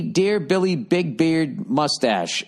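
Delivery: high, very slow grand greeting; hammed-up letter reading. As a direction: stretch it out, grandly.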